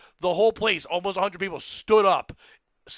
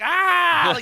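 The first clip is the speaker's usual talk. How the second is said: long, high, loud mock scream; drawn-out mock crowd yell; imitated crowd roar; 10 semitones higher, 7 dB louder than usual. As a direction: holler like an audience losing it. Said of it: "people are just running around like".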